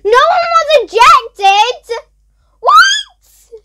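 high, loud disbelieving cry of what; stunned high shriek; shocked shout. Real, not acted. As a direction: yelp in total disbelief. Shock at voting result.

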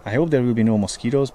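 ordinary talk, nothing marked

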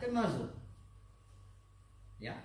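normal talking voice

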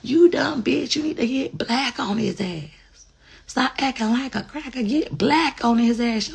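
high rough-edged mama scolding; mom voice. Retold or imitated. Mom scolding crying daughter, high mock scold. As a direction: bark it like a fed-up mother. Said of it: "my mom used to be like".

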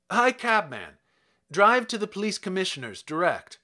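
urgent call to a cab. Acted. Kovalev hails cabman.

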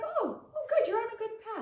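very high-pitched imitation of approving people; mock approval. Imitating people who approve once she is thirty, mock approval. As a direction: coo it up high, imitating others.

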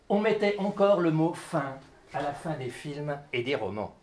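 measured reading, sinking softly at the end; literary ending, winding down; closing line recitation. Acted. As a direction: close the text gently, falling in pitch. Reads closing line of text.

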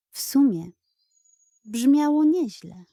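ordinary delivery

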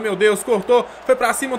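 normal talking voice

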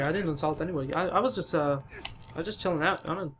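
normal talking voice

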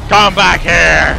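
slow, loud chasing yell; yelling after it. Real, not acted. Player chasing a creature, slow loud yell. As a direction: bellow after a fleeing creature.

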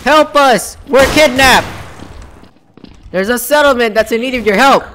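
kidnapped militiaman calling for rescue; high, loud, urgent role-play shout; Preston Garvey. Acted. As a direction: call out for help.

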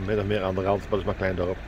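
ordinary talk

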